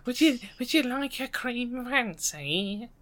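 put-on dainty little old lady, softly offering; old woman. Offering a cake as an old woman, old woman voice. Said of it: "give me an old woman accent".

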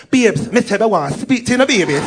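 exaggerated lisped s in "speak to you"; forced sexy lisp. Jamaican woman trying to sound sexy, lisping "babies". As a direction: put a heavy lisp on "Babes". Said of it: "putting on a lisp".

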